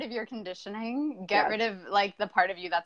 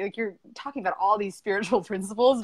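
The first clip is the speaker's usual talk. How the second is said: ordinary talk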